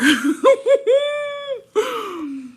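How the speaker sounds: long, high squealing laugh; shrieking laughter; high cackle. Real, not acted. Laughs at own joke.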